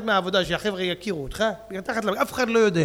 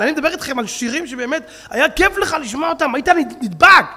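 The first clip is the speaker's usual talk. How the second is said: loud, scornful preaching, mocking today's songs; preaching at full force; 5 semitones higher, 6 dB louder than usual. Rabbi mocking modern music to his congregation, loud fiery preaching. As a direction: rail at the crowd like a fiery preacher.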